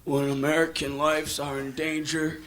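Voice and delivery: low, slow, humble impression; Oliver North. Witness at the hearing when nearly caught, voice gone down low. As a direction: drop the voice into solemn humility. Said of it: "his voice would go down".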